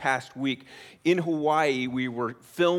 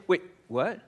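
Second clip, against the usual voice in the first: imitating kid's "wait, what"; 2 semitones higher than usual. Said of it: "I hear".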